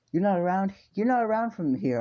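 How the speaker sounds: high, loud merchant voice; put-on inquisitive stallholder; nosy market seller. Seller sizes up the stranger.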